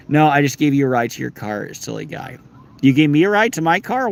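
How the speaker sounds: ordinary talk, nothing marked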